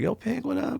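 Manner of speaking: high squeaky fanboy hello; mock high-pitched fan shout; excited fan greeting. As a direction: call out high like a starstruck fan.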